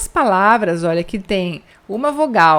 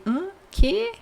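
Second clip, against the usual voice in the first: a high, puzzled "Hã? Quê?"; confused listener asking what; 11 semitones higher than usual. Retold or imitated. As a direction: play someone who can't understand you. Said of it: "as pessoas ficam assim".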